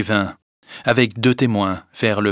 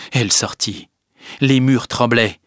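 ordinary delivery

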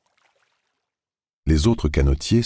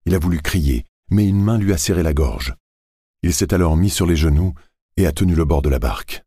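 usual speaking voice

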